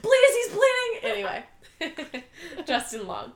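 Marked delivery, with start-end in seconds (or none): 0.0-0.9 high, loud, laughing mock panic; mock frantic plea. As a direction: plead with hammed-up distress. Acting out frantic movie line.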